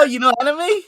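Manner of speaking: high, loud, rising surprise; surprised question. Retold or imitated. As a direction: replay delighted surprise at full volume. Acts own surprised question to manager.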